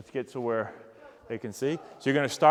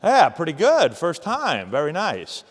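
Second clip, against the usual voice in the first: loud praise; 6 dB louder, slower than usual. Real, not acted. Coach praising the player after his first run, loud. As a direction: call it out, loud and pleased.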